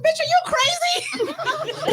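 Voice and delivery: shocked slow shout; incredulous shout. Retold or imitated. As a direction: yell it in disbelief. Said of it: "I said".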